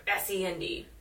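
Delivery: ordinary delivery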